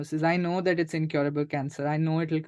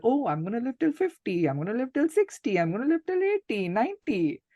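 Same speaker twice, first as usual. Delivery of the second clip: carefree imitation; 7 semitones higher than usual. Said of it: "thinking that".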